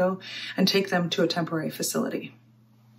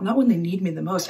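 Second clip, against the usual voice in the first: ordinary talk, nothing marked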